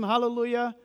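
normal talking voice

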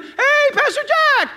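very high, drawn-out holler; mock boisterous hello; loud greeting. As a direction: holler slowly across the shop. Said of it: "Christians are loud people".

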